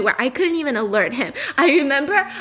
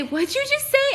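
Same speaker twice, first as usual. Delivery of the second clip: imitating herself; 12 semitones higher than usual. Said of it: "I always say".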